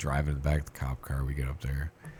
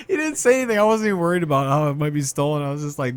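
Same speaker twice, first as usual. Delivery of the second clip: high, loud, laughing through the words; real laughing talk; 9 semitones higher, 6 dB louder, faster than usual. Real, not acted. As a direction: struggle to talk while cracking up. Cracking up over virginity book.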